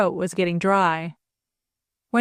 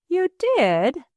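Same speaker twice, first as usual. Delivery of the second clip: much higher, startled question; astounded; 9 semitones higher than usual. A narrator's reading. Stepdaughter stunned by stepfather's confession, astounded question. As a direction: gasp it out in disbelief. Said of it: "astounded at her stepfather".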